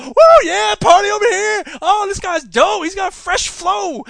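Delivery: high, loud party whoop; partygoer whoop. Retold or imitated. Host mimicking partygoers cheering loudly. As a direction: cheer like a crowd at a club. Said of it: "everyone will go".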